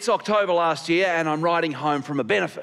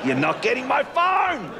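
high, defiant yell; comic protest, pitched up high; defiant cry; 7 semitones higher than usual. Acted. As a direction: cry it out, refusing to give up the phone. Defiant cry while clutching his phone.

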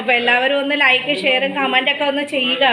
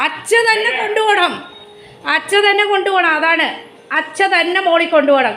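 pet talk; 8 semitones higher, 3 dB louder than usual. Real, not acted. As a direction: owner cooing to her dog that wants to go out. Talking to dog.

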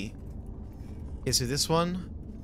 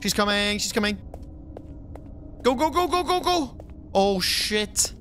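panicked, pitched-up urging; being chased; 5 semitones higher than usual. Real, not acted. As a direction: flee in real panic. Fleeing the monster in game.

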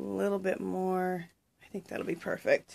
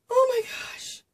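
much higher, louder squeal of joy; squealing delight; 5 dB louder, slower than usual. Real, not acted. Crafter opening friend's parcel, high excited squeal. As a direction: squeal it like a thrilled fan. Sees another lace piece.

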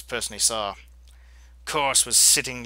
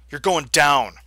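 louder, defiant repeat; loud declaration; 8 dB louder, slower than usual. Real, not acted. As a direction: say it like a threat.

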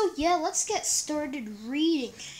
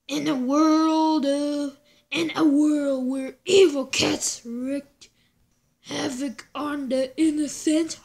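horror trailer voice; 3 dB louder than usual. Acted. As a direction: boom it like a movie trailer. Comic intro read as trailer.

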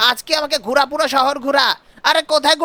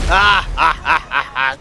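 low effortful monster grunts; monster groans; 9 semitones lower, slower than usual. Acted. Monster striking the crowd, low grunting. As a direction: grunt like a beast mid-attack.

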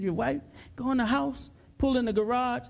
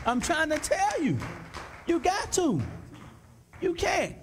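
normal talking voice

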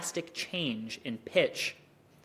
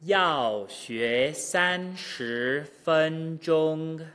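very slow, every syllable held apart; exaggerated slow drill; much slower than usual. Shown as a demonstration. Teacher drilling back to front at natural pitch, very slow. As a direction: stretch each tone out deliberately. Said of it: "exaggeration and slow speech".

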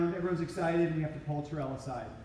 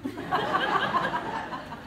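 bursting out in a high laugh; can't hold the laugh in; sudden high giggle; 4 dB louder than usual. Real, not acted. Laugh after own quote.

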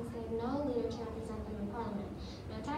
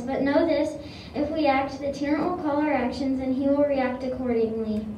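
loud, declaiming patriot orator; rally the room with every line; rousing speech; 14 dB louder than usual. Acted. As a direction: Sam Adams stirring up the Sons of Liberty. Sam Adams addresses the meeting.

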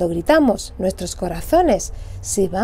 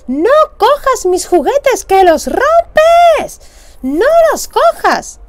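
far higher, louder, slow stretched yelling; angry shout; 12 semitones higher, 6 dB louder, slower than usual. Acted. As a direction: scream at your little sister over the broken toy. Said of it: "le dije gritando".

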